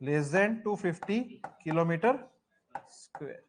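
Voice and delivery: words stretched out very slowly, fading at the end; slow dictation. Shown as a demonstration. Teacher dictating the answer to students, slow and deliberate. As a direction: dictate it slowly so they can write it down. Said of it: "teacher dictates a note to class".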